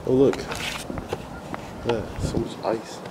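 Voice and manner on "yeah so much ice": usual speaking voice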